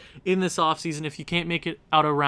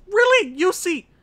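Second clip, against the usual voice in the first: loud, very high exasperated cry; incredulous outburst; 13 semitones higher, 5 dB louder than usual. Real, not acted. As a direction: yelp your disbelief at the goalie. Disbelief at goalie's performance.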